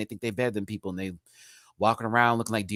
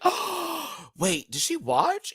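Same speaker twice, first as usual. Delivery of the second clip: surprised; much slower than usual. Retold or imitated. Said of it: "I was like".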